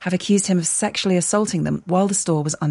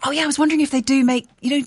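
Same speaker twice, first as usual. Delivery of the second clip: normal talking voice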